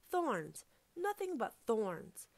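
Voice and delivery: loud exclamation, slowing down; exclaim, then stretch it out in disbelief; indignant discovery. A narrator's reading. Fox looks out and sees only thorns, indignant.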